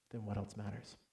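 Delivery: hushed, low, tender reply; quiet reassurance. Retold or imitated. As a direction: say it gently, almost under your breath. Wife's quiet answer retold.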